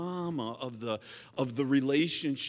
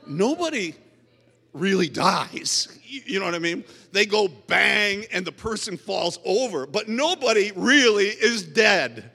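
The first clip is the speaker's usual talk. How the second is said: exasperated explanation; 5 semitones higher, 11 dB louder than usual. Retold or imitated. Preacher acts out his baffled explanation.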